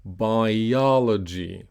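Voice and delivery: slow "Biology", both I and O clear; "Biology" with a long, stressed second vowel; repeat-after-me model. Shown as a demonstration. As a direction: stretch "Biology" as bi-OL-ogy. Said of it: "So repeat after me".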